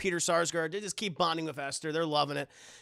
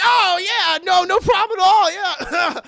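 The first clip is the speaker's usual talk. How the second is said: very high, loud fake-friendly yes; forced cheery; 13 semitones higher, 12 dB louder than usual. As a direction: overdo the fake welcome. Dad cheerfully hiding annoyance.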